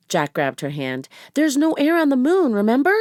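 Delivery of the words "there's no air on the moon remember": louder, higher, pressing the point; alarmed reminder to a reckless sister; urgent warning. Acted. Jack warning Annie about the airless moon, loud urgent reminder.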